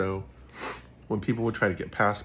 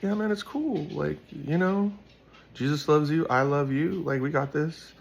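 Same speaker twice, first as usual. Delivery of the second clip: high, reassuring comfort; 8 semitones higher than usual. Retold or imitated. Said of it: "I was like".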